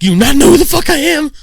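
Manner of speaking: high, loud outburst as the crazed cellmate; mock yelling. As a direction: yell it like an unhinged inmate. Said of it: "like, don't yell".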